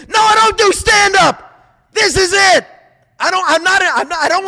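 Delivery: high, loud snapping retort. Real, not acted. Answering a mocked fan in a loud retort. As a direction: shout the answer down. Shouting down imagined question.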